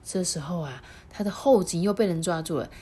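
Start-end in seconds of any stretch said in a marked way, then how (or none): none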